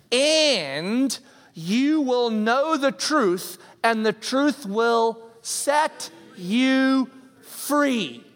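grand, drawn-out preaching of the promise; dramatic scripture proclamation. Acted. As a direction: lift this verse up slowly like a revelation. Proclaiming a key scripture verse.

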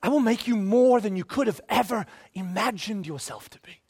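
a hushed Jesus making a promise; Jesus promising. Retold or imitated. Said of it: "He goes".

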